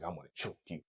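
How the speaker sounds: low, hushed mock menace, lightly done; mock creepy old guy. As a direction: drop low and quiet like a creepy older man. Mocking Shannon's tapes.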